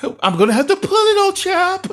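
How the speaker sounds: hammed-up falsetto with a mock English "old chap"; mock Billy Joe reply. Mocking Billy Joe answering Ben in a hammed-up falsetto. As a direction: whine back in a silly high voice.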